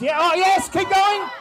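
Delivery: loud high cheer; excited shout. Real, not acted. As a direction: yell encouragement mid-lift. Announcer cheering lifter live.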